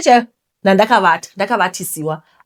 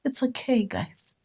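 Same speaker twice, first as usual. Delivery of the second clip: normal talking voice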